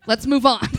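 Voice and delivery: laughing through the words; giggling aside. Real, not acted. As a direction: chuckle it out. Laughing through own line.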